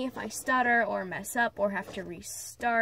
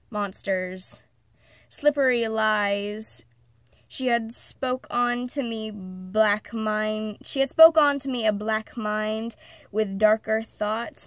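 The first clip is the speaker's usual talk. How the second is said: dramatic recital, leaning on key words; emphatic story reading; 5 dB louder than usual. Acted. Reading of rage at her mother's abuse, dramatic recital. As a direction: declaim a dark confessional poem with weight. Reads own dark story aloud.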